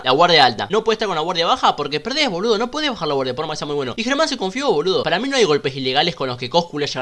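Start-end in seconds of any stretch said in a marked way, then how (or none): none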